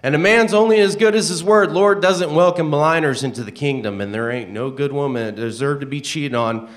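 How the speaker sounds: loud, high, preaching rant. Acted. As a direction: lecture him like a fired-up preacher. Reading a character's preachy rant.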